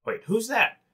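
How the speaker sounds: startled guard calling out; sharp guard challenge. Acted. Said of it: "they're like".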